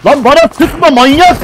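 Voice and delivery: loud, high angry yell; angry shout. Real, not acted. Yelling at a teammate who shoots him, furious. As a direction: snap at the teammate to stop shooting.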